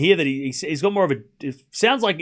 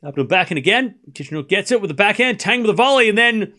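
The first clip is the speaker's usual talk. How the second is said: high, loud tennis rally call; excited play-by-play; 7 semitones higher, 6 dB louder than usual. Real, not acted. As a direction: build to the winning point and break. Live play-by-play, break of serve.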